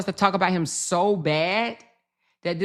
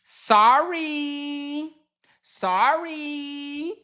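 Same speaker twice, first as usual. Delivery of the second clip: drawn-out, higher mock sorry; mock apology; 8 semitones higher, much slower than usual. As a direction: sing out a fake sorry.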